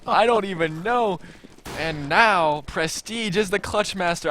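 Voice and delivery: loud, high, amazed recap; excited. Real, not acted. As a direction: still buzzing from the play. Still hyped after the clutch.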